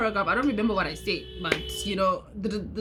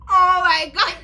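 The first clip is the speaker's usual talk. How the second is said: loud, high yo and oh my God; shocked outburst; 8 semitones higher, 11 dB louder, slower than usual. Real, not acted. Reliving her friend's reveal, a loud shocked outburst. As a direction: blurt it out, loud and stunned.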